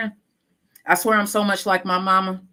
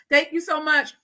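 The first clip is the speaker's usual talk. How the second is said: ordinary talk, nothing marked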